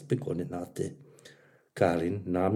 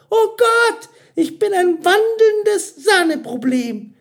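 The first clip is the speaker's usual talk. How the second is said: very high, loud self-reproach; panicked server; 11 dB louder than usual. A narrator's reading. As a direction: wail in comic high-pitched dismay. Mira in dismay at her mistake.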